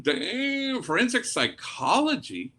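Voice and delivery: surprised. Retold or imitated. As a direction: act amazed at the daughter's answer. Daughter answers, priest reacts surprised.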